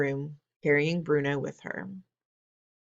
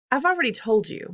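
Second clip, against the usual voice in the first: higher, louder grandmother voice; she's already told them, insisting; firm grandmother; 9 semitones higher, 5 dB louder than usual. Acted. Grandmother answers firmly.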